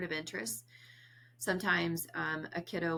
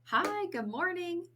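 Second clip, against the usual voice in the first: much higher, slow sing-song hello; cheery greeting; 13 semitones higher, 4 dB louder, slower than usual. Shown as a demonstration. Therapist greeting a child each morning, sing-song and bright. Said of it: "a lot of times we'll say".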